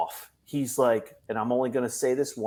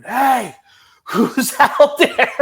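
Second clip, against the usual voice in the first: high, loud panicked yell; mock panicked scream from a locked car; retold scream; 12 semitones higher, 8 dB louder, much slower than usual. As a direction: scream it out, scared and trapped. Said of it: "I mean, screaming".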